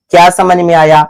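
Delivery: ordinary delivery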